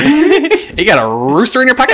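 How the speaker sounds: high teasing quip. Retold or imitated. Teasing the rooster-ringtone man, high and mocking. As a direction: tease him like a joke line.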